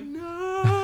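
high, loud wail of no; mock panic. Victim circles in a room of dolls, mock-panicked wail. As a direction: wail in hammed-up horror. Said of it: "He's just gonna turn around in circles like".

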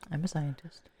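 breathy whispered claim; whispering. Pretend. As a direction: whisper it like a secret.

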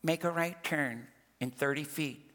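lightly voiced navigation prompt; soft, measured automated direction; MapQuest. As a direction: give a hint of a sat-nav voice. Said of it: "what MapQuest tells me to do".

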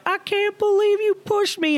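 very high-pitched imitation of hurt shock; mock disbelief. Pushed boy looks up at her, high mock disbelief. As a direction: squeak out a betrayed victim's protest.

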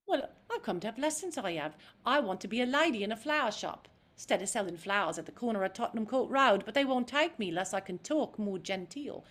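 chirpy and sure of herself; Cockney Eliza. Shown as a demonstration. Eliza asking Higgins for lessons, bright Cockney. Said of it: "see the difference on my face".

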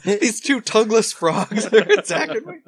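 slow, laughing complaint. Real, not acted. Laughing at being attacked.

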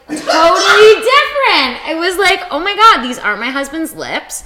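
high, loud, stretched-out astonishment; amazed excitement. Retold or imitated. Woman retelling her first kiss with someone new, high amazed excitement. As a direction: exclaim it high, reliving the shock.

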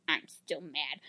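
low, soft, wounded mumble; small, quiet sulk. Retold or imitated. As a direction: imitate a hurt man's tiny voice. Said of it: "saying in a very small voice".

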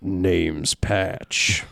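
a country take on "Name's Patch"; too-country accent. Shown as a demonstration. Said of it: "No, too country".

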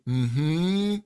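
a hum sliding upward; rising mm-hmm. Shown as a demonstration. Coach humming mm-hmm to find where it vibrates, sliding upward. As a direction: slide the mm-hmm up to find the buzz. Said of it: "as I feel that slide up".

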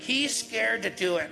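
high, loud, drawn-out jeer; mock kid's yell. Girl on ladder announcing he's scared, yelling. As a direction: imitate Jenny calling it out slowly. Jenny mockingly passes word down ladder.

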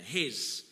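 "His" said alone, firm and weighty; stressed word. Shown as a demonstration. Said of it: "I'm emphasizing one word deliberately".

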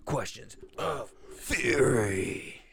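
slow, dramatic hype announcement; announcer voice. Acted. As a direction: build it up like a show host. Announcing the show segment.

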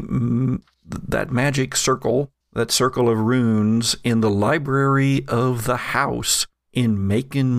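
normal talking voice

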